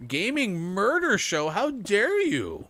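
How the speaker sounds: high, loud, slow mock outrage. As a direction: gasp it out, scandalized. Mock outrage at a cow kill.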